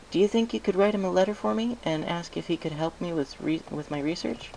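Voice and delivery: lightly voiced Twilight asking a favour; Twilight's voice. Twilight asks for a letter.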